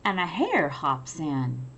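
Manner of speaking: lively picture-book read-aloud; reading to children. A narrator's reading. Teacher reading a picture book to children, lively. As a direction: read it like bedtime for little ones.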